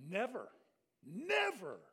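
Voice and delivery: firm double "never" of refusal; emphatic never. Shown as a demonstration. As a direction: punch each "never", the second harder. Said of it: "That's a way of saying it like I just did".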